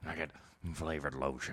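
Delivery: low, quiet creepy character voice; Penguin. Acted. Host quoting a movie villain back at his friend, creepy Penguin voice. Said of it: "What was that voice?".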